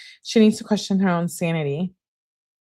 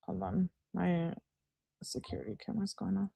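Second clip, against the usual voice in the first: much softer, half turned away from the mic; distracted aside; 2 semitones higher, 11 dB quieter than usual. Real, not acted. Host breaks off reading to check her camera, softly.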